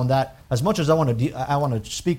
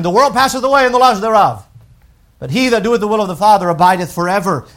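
raised, louder reading of the verse; scripture reading; 5 semitones higher, 7 dB louder than usual. Acted. Preacher to congregation, reciting the verse, raised and louder. Reads scripture aloud in sermon.

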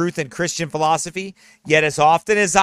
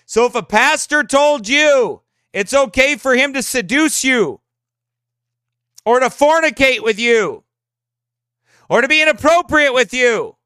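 fervent raised preaching voice; 5 semitones higher, 3 dB louder than usual. Real, not acted. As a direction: preach it with rising fire. Preaching pastor.

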